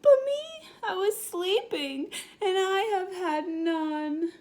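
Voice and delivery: high, wailing sob of a hurt bear; whimpering bear. Acted. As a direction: cry it out, feeling left out. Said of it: "He whimpers and he moans and he wails".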